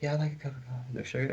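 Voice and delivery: imitating Hugh Jackman asking softly for coffee; a barely audible, humble mumble; meek, quiet request. Star quietly orders coffee from waiter, soft and meek. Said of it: "kinda humble and meek".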